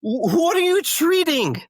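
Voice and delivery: very high, loud, drawn-out yell; angry outburst. Retold or imitated. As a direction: explode at the therapist suggestion in disbelief. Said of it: "you seem angry about this".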